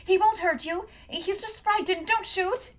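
high, loud pleading cry; girl shouting to save her dog; desperate shout. A narrator's reading. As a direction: scream it to stop them shooting. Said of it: "grabbed him by the collar and shouted".

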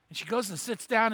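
ordinary talk, nothing marked

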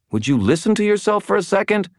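high, quick, sharp reproach; pointed and rapid, pitched high; sharpening voice. A narrator's reading. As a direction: cut in sharply, exasperated. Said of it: "her voice sharpening".

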